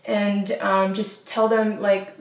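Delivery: ordinary talk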